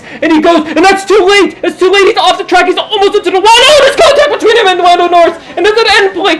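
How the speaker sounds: high, loud laughing shout at the crash; excited. Acted. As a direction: yell it out as the cars touch. Crash during race call.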